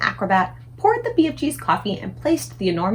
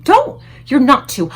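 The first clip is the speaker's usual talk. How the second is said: much higher, loud urgent plea; alarmed pleading; 5 semitones higher, 9 dB louder than usual. A narrator's reading. Sophie begging the giant not to, high and loud. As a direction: beg him to stop.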